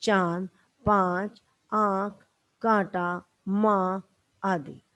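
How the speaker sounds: slow चांद, पाँच, माँ with nasal vowels; nasalized vowel examples. Shown as a demonstration. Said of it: "जैसे". (धीमे-धीमे अनुनासिक स्वर के साथ)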